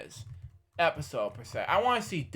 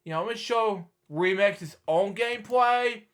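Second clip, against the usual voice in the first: usual speaking voice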